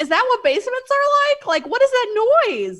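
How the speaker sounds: very high-pitched exasperated disbelief; incredulous. Retold or imitated. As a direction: throw the excuse back, voice soaring in protest. Incredulous comeback to the quoted line.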